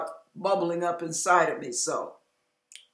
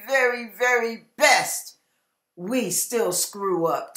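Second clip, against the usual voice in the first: ordinary talk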